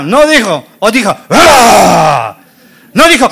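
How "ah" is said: loud hammed-up scream; mock scream. Preacher imitates a scream for the congregation, mock scream. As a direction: let out a mock frightened "ah".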